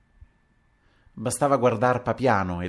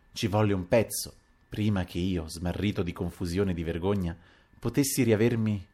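plain reading voice

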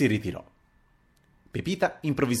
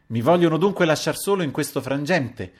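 higher, exasperated cry; exasperated outburst; 7 semitones higher, 4 dB louder than usual. A narrator's reading. Narrator left alone in his predicament, exasperated outburst. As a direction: burst out at being abandoned. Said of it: "proruppi ancora una volta esasperato".

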